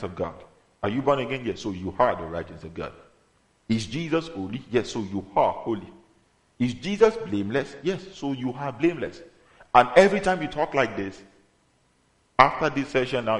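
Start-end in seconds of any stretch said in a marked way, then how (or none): none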